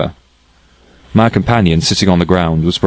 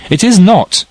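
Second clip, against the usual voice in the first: much higher and louder, snapping back; sharp retort; 13 semitones higher, 4 dB louder, slower than usual. A narrator's reading. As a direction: fling the denial straight back. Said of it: "retorted she".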